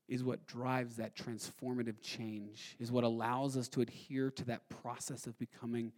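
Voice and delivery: slow, low, soft sermon delivery with long pauses; hushed preaching. Real, not acted. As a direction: let each phrase sink in, quietly and deliberately.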